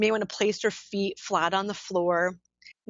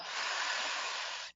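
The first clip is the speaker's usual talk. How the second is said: slow, soft sigh of breath; long audible exhale; 10 dB quieter than usual. Shown as a demonstration. Models the exhale she asks for.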